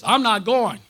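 wife flatly refusing; firm refusal. Retold or imitated. Wife refusing to go to the meeting, loud flat no.

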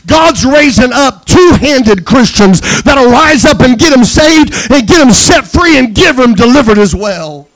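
loud, soaring pulpit cry, pushing every phrase; rally the congregation like a revival preacher; full-force preaching. Acted. Pastor exhorting the congregation to be two-handed Christians, loud and fervent.

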